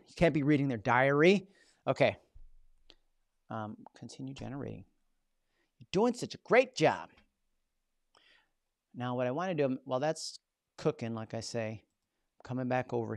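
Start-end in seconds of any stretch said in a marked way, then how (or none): 5.8-7.1 bright coaxing praise to the machine; mock praise. Praising the AI tool as if it could hear, playful. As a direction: cheer the software on like a pet.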